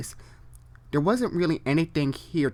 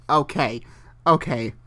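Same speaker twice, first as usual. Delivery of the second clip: ordinary talk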